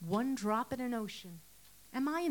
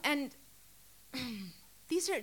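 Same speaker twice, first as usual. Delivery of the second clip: ordinary talk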